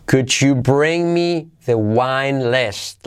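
slow, careful read of the sentence; slow model sentence. Shown as a demonstration. Teacher reading the wine list request slowly to students. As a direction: say it slowly, word by word. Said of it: "Pero de nuevo".

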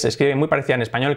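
ordinary delivery

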